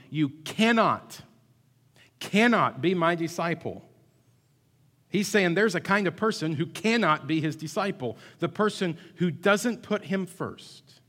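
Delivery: fiery sermon peak; full-force preaching. Real, not acted. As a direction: preach it hard to the pews.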